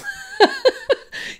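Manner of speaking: real laugh, high and loud; high burst of laughter. Real, not acted. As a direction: let a high giggle burst out. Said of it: "I'm laughing".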